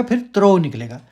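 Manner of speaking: normal talking voice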